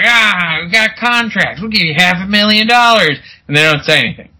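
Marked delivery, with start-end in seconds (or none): 0.0-3.2 mock eager agent. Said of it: "the agent's like".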